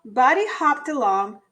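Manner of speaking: usual speaking voice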